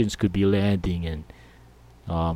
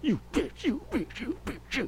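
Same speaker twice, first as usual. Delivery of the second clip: high, soft hissed jabs, one per step; hissed curse; 8 dB quieter than usual. Retold or imitated. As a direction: spit it under the breath with each stride. Friend stomping while running.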